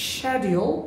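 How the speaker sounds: schedule said as SHED-yool; British pronunciation. Shown as a demonstration. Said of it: "British উচ্চারণ হচ্ছে". (schedule British ঢঙে শেডিউল)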